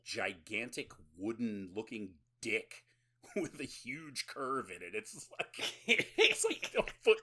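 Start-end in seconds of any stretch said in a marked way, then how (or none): 5.8-7.2 high, loud, cracking up mid-sentence; laughing while talking. Real, not acted. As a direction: struggle to speak through real laughter. Cracking up over the prop.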